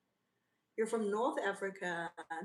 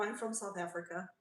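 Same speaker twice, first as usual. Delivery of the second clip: ordinary delivery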